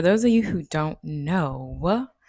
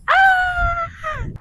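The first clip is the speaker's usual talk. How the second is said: high sharp yelp as the run starts; sudden yelp; 6 dB louder than usual. Real, not acted. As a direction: let out a quick cry.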